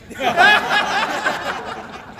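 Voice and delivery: real high-pitched laugh; laughing mid-story at the memory; high burst of laughter. Real, not acted. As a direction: break into laughter. Laughing at the guy's reaction.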